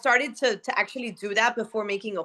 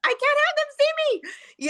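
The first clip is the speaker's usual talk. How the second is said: high, squealing panicked voice; much higher mock alarm. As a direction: squeal it like you've been caught without makeup. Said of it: "I will be like".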